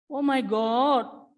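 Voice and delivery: slow, drawn-out exasperated sigh of words; Oh my God stretched out in despair. Real, not acted. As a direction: let it drag out in disbelief. Teacher exasperated at wrong answers.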